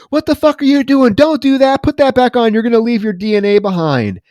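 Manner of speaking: loud, high-pitched mock outburst; retold angry scolding. Felix berating the killer over his careless mistake, acted angry yelling. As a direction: imitate a panicked boss telling someone off.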